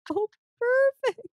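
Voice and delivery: high, drawn-out fake pleasantness; slow it down and pitch it way up, feigning delight; mock delight. Told by a date her grammar slips, mock delight. Acted reply to date's humbling remark.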